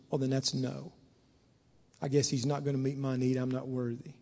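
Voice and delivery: low, soft resignation; discouraged giving up. Acted. Believer giving up on an unanswered prayer, discouraged. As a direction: let it sink quietly, defeated.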